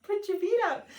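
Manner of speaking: high, quick mock scolding; mock bossy voice. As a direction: play a fussy nurse ordering her off her feet. Mock voice telling herself to rest.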